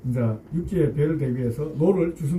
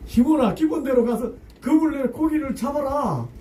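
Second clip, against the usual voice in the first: Jesus's words raised high and solemn; solemn command; 8 semitones higher than usual. Acted. Jesus tells Simon to cast nets, solemnly raised. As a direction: lift it up like a solemn divine command. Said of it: "이 얘기를 하시는 거예요".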